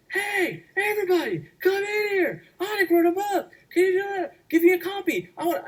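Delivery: high, loud excited shout to the room; excited calling out. Retold or imitated. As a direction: call everyone in, thrilled. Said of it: "And she goes".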